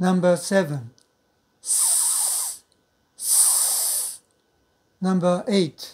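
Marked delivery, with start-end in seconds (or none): 1.6-5.0 スー as soft hissing air, no voice; voiceless fricative. Shown as a demonstration. Teacher drills number seven for learners, スー soft and voiceless. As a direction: let a long, soft stream of air out on スー. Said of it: "赤い印の方は無声音".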